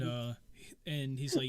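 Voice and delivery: usual speaking voice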